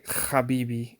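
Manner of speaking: "habibi" with a rasped kh at the start; modern Hebrew pronunciation. Shown as a demonstration. Teacher quoting modern Hebrew speakers, rasped kh. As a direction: start "habibi" with a throat-scraped kh. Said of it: "that's not correct".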